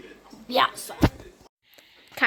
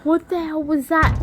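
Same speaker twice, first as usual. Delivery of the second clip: usual speaking voice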